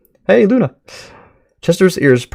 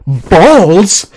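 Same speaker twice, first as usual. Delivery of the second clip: taken aback; 7 semitones higher, 7 dB louder than usual. Acted. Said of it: "Chester suddenly seems taken aback".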